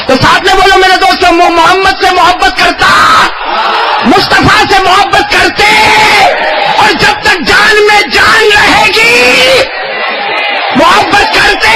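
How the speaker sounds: rousing call and response. Acted. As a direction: lead the hall in a fervent shout.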